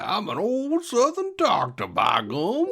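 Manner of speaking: high, hammed-up Southern country doctor; Southern accent. Mocking McCoy's Southern roots for a co-host. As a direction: ham up an old Southern doctor. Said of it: "he goes back to his roots".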